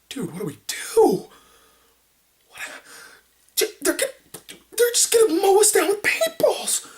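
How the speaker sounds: high, panicky voice rising to a squeak; a friend scared of getting mowed down; panicked buddy. Retold or imitated.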